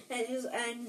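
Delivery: usual speaking voice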